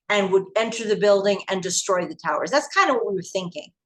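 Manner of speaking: normal talking voice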